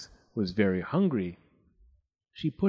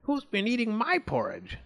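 high, indignant mother voice; indignant Mummy Bear; 9 semitones higher than usual. A narrator's reading. Mummy Bear finds her porridge eaten, high indignation. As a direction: call it out higher, without real shouting. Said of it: "she shouted".

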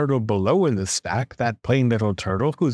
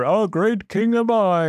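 proud turtle king, pitched up high; lofty, high-pitched bragging; boastful king; 8 semitones higher than usual. A narrator's reading. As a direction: crow it like a self-satisfied monarch. Yertle boasting over and over.

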